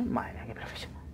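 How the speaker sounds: soft mocking whisper; sarcastic whisper. Real, not acted. Mocking his own shots, sarcastic whisper. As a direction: whisper the praise with irony.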